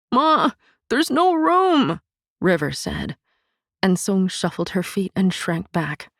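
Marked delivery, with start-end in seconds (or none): 0.1-2.0 put-on high, slow small boy's voice; a frightened child pleading; timid boy. A narrator's reading. Son telling his mother there is no room, timidly.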